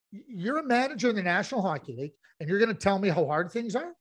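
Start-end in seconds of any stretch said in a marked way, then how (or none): none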